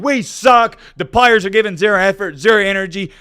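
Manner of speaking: loud, high angry coach tirade; acting out a coach chewing out the team; berating. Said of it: "started berating everyone".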